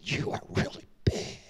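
retold awestruck whisper; awed whisper. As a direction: whisper it up at a giant angel. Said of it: "I said".

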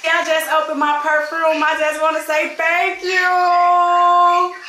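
crying out thanks to her friend; tearful thanks. Real, not acted. Thanking Kay for the gift, tearfully loud.

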